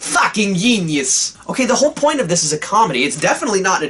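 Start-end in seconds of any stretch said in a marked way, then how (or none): 0.0-1.4 slow Viking voice, y for g in genius; mock Scandinavian accent; hammed-up Viking cartoon voice; Oleg the Viking. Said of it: "as Oleg would say it".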